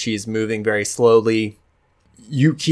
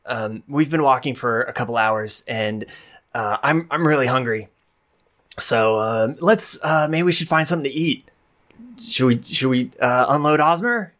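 higher, hesitant peacemaker voice; nervous Sorrel; 4 semitones higher than usual. Acted. Sorrel scrambling over to calm the fight, high and nervous. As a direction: stammer out a nervous suggestion. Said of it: "She goes".

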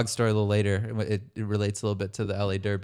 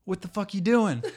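retold aggressive stranger; 9 semitones higher, 3 dB louder than usual. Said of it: "this guy out of nowhere is like".

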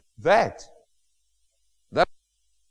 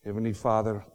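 prayer; 7 semitones lower, 10 dB quieter than usual. Acted. Pastor leading congregation in closing prayer, soft and low. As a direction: speak gently to God.

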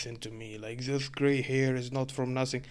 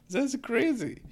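much higher, incredulous squeal; the speaker squeals in disbelief at having to explain Satan; squeaky disbelief; 12 semitones higher, 4 dB louder, slower than usual. Real, not acted. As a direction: pitch way up in disbelief.

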